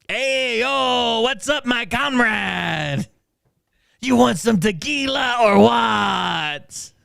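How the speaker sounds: high, slow, hammed-up Mexican accent; mock Mexican accent, drawn-out and high-pitched; cringy Mexican accent. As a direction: call out like a cartoon Mexican buddy. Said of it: "my Mexican impression probably sounds as cringy".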